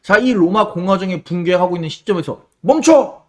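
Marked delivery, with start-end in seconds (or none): 2.6-3.2 loud, high call to halt; raised-voice order to stop; shouted command. Acted. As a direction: shout it like you're stopping a crowd. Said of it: "하고 외쳤던 사람들".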